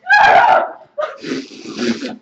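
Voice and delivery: high shriek-laugh; panicked shrieking laugh. Real, not acted. Shrieking while grabbing bird.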